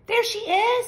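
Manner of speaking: high, loud hopeful cry; excited baby bird. A narrator's reading. Baby bird sees the boat, excited and high. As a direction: spot her and call out with joy.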